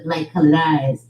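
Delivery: normal talking voice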